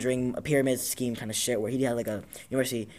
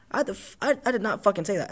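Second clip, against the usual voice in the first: a mildly mock-outraged kid, pitched higher; indignant denial; 7 semitones higher than usual. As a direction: protest the accusation in a raised, higher voice. Kid denies accusation at lunch.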